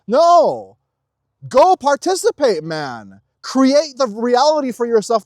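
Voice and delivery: fired-up rallying cry; forceful command. Real, not acted. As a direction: shout them into action. Forceful exhortation.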